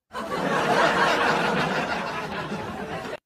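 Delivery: long gloating cackle; loud evil cackle. Real, not acted. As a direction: cackle in triumph. Gloating over haters. (tawa jahat keras)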